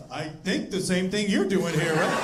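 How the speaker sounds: a hint of a cocky teen, chuckling; teen son smugly teasing dad, through a laugh; lightly voiced son, laughing.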